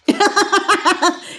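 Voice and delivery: high delighted laugh; bright gleeful laughter; happy laugh. Real, not acted. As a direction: laugh out of pure joy. Joyful about bright future.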